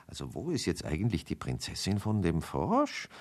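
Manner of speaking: soft, murmured question; puzzled, half to himself. A narrator's reading. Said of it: "murmelte Markus".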